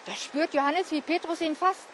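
plain reading voice

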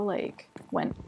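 breathy imitated cow sigh, no voice. Vlogger mimics annoying Minecraft cow, airy imitated sigh. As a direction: mimic a cow's huffing sigh. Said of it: "it goes, it, like, sighs".

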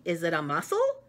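ordinary delivery

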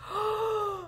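sharp high gasp of delight. Real, not acted. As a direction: catch your breath at a lovely gift. Opening gift, delighted.

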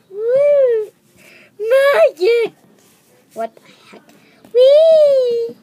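high loud gleeful squeals; playful shouts of glee; excited whoops. Pretend. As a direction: squeal with delight while bouncing. Toys bouncing, cheering.

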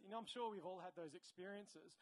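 ordinary talk

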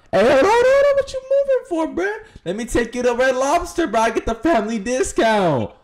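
hammed-up high flirting; high flirty voice. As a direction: squeak out the come-on.

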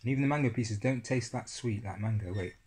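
spoon at the lips; speaking between spoonfuls; talking while eating yogurt. Real, not acted. Seen 2: Spoon at lips eating yogurt from cup throughout speech.

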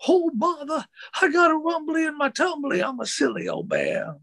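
high, husky cartoon bear voice; full-on impression of a silly storybook bear; Winnie the Pooh. Actor showing off his Winnie the Pooh for a colleague, high cartoon bear voice. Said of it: "I do a mean Winnie the Pooh".

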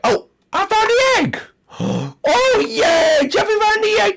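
high, gasping yay; squealing little-boy joy; excited cheer. Acted. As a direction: cheer like a thrilled kid. Found egg in game.

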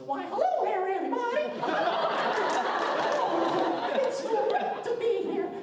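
very high squeaky cartoon mouse with giggles; Mickey Mouse. The mouse greeting the room, very high and giggling. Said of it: "He just walks into the room and goes".

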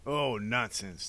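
dismissive Doctor Lucafont. Acted. Doctor dismissing the children's accusation, scornful. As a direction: wave it away with drawn-out disdain.

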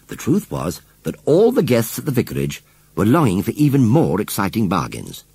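plain reading voice

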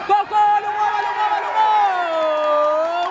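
repeated urgent cry into the goal; goal shout. Real, not acted. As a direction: keep shouting as it goes in. Gol narrado ao vivo. (grito de gol repetido)

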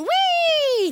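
gleeful whee. Pretend. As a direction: squeal as you slide down. Sliding down the fire pole.

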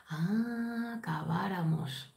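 soft, slow "acabáramos", almost under the breath; knowing aside. Retold or imitated. Fan quietly realising what she really wanted, slow and knowing. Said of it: "luego dices".

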